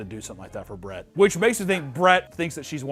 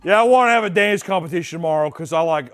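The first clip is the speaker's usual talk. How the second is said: loud, raised mock-drunk voice; imitated drunk; 4 semitones higher, 9 dB louder than usual. Host mimics the smashed star wanting a contest, mock drunk. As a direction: blurt it with smashed enthusiasm. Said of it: "while he's smashed".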